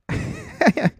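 high burst of laughter; amused laugh at a clip. Real, not acted.

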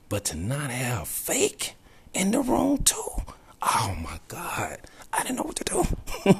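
high, tearful voice breaking into laughs; real near-crying, pitch leaping high; overcome with emotion. Real, not acted. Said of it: "Not gonna get emotional".

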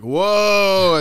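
loud, high amazed whoa; awed whoa. Retold or imitated. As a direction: gasp out a stunned whoa. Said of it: "you were still like".

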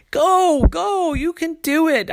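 put-on high, loud encouraging kid; a high child's voice cheering loudly. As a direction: cheer him forward. Sister urges him on.

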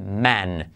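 "man" said as men with a short E sound; plural men. Shown as a demonstration. Teacher showing plural to class, "man" said as men. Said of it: "y en plural".

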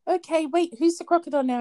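high voice for playing with a little kid; retold game talk, lifted high; imitated playful child-talk. Playing crocodile game with small boy.

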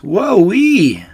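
high, loud whoop; amazed gasp. A narrator's reading. Smallest fox reeling from fiery cider, amazed whoop. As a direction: whoop as the strong drink hits. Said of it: "he gasped".

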